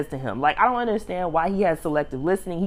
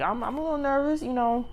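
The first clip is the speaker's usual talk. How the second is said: higher, slightly softer mock-nervous voice; 6 semitones higher, 3 dB quieter than usual. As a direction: imitate a nervous guy, pitched up. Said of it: "she could tell he was nervous".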